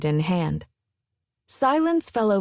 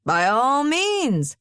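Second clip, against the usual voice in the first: slow, higher, grand flourish; 6 semitones higher, much slower than usual. Acted. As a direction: sweep it out with grandeur. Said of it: "gesturing grandly".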